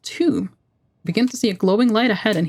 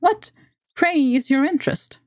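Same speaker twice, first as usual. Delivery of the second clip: much higher, guarded squeaky elf; high elf voice asking warily; wary elf; 8 semitones higher than usual. Acted. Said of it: "Her tone is wary".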